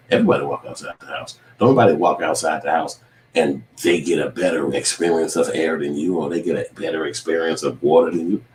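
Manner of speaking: ordinary talk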